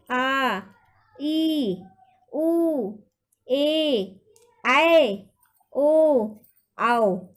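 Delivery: each long vowel stretched and called out loud, one at a time; लंबे स्वर खींचकर, ज़ोर से; long vowels drawn out. Shown as a demonstration. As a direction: hold every vowel twice as long, teacher calling to the class. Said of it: "ह्रस्व से दूना समय लगता है".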